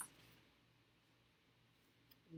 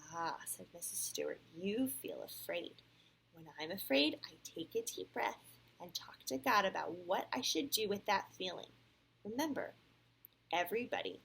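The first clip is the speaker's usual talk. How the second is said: clear, animated read-aloud; storybook voice with lively rises and falls; picture-book narration; 8 semitones higher, 9 dB louder than usual. A narrator's reading. Parent reads Sam's story to a child, animated storytelling.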